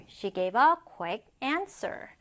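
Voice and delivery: slow but connected example sentence; joined-up model reading. Shown as a demonstration. Teacher repeating the example whole for students, joined-up model reading. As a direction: say the whole sentence through, slowly.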